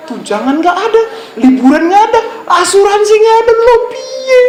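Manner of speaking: rising, whiny list of grievances; fed-up complaint. Acted. A worker complaining to the boss, indignant and whiny. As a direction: sound cheated and indignant.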